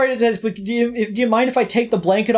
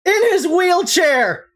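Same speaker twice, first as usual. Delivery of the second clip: pitched up high and slowed, a big triumphant lawyer reveal; dramatic lawyer accusation; 8 semitones higher, much slower than usual. Acted. Defense attorney revealing the hiding spot to the court, high and triumphant. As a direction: deliver the gotcha like a courtroom ace.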